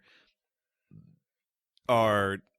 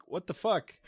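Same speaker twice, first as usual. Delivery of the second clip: mock disbelief; 8 semitones higher than usual. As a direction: lightly act out stunned disbelief. Acting out Sybok's stunned reaction.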